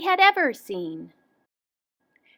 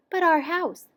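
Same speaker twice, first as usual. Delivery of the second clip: higher character voice; a hint of a higher wife's voice; lightly voiced wife; 6 semitones higher than usual. A narrator's reading. Gardener's wife objects to the tree, lightly voiced.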